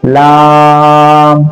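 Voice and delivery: लाम with the a held very long; elongated madd. Shown as a demonstration. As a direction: stretch लाम steadily on one note. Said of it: "इसको खींच कर पढ़ेंगे".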